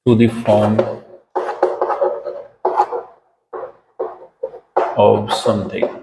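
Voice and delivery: very slow, word by word; slow dictation. Shown as a demonstration. Teacher dictating the meaning to students, very slow. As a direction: dictate the meaning slowly for notes. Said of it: "इसका meaning है".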